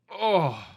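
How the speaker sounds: a sudden high, loud "oh" of imitated pain; mock pain yelp. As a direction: yelp as the nose hair rips out. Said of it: "and you're like".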